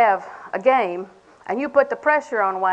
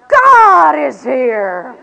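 very slow and much louder, a mock-solemn proclamation; melodramatic; 3 semitones higher, 11 dB louder, much slower than usual. Mocking a woman's spiritual melodrama, slow and overblown. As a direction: ham it up like a swooning believer. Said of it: "respond with melodrama".

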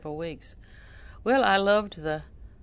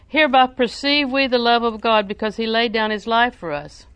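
louder, solemn Bible reading; scripture reading; 4 semitones higher, 7 dB louder than usual. Acted. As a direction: proclaim the verse to the congregation. Reads scripture aloud.

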